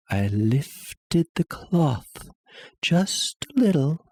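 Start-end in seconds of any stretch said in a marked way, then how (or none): none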